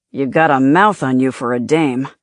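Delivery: low, tough mobster sneer; gangster voice. A narrator's reading. Gangster taunts Lauren.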